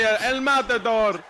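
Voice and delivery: loud, high, slow call; drawn-out catchphrase. Pretend. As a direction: stretch it out like a battle cry. Calls out catchphrase in match.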